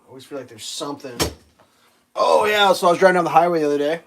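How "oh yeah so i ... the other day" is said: excited. Real, not acted. Suddenly remembers story.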